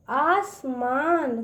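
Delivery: आसमान slow, each part apart; sounding out syllables. Shown as a demonstration. Teacher sounds out a word for children, slowly. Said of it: "उसको तोड़ तोड़ पढ़िए".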